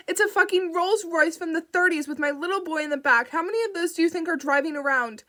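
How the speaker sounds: furious mother, words tumbling out; angry outburst. Acted. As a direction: lash out at the dispatcher's stupid question. Frantic mother snaps at dispatcher.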